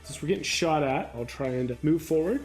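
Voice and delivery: ordinary talk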